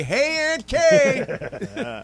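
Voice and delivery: calling out to a relative in a crowd. Retold or imitated. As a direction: call the name across the gallery. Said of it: "just say".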